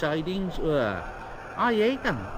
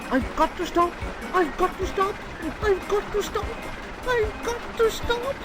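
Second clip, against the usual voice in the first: rising from high to much higher panic; each cry higher, more desperate; frightened. A narrator's reading. James careers down the hill, rising panicked cries. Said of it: "James was frightened".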